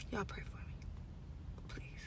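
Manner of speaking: breathy whispered plea; whispering. Real, not acted. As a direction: whisper it, worn out. Tired at work, pleading.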